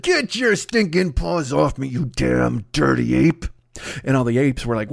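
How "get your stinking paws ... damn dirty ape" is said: slow, drawn-out theatrical movie-hero growl; Charlton Heston impression. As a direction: stretch every word like a dramatic film line. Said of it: "when Charlton Heston said".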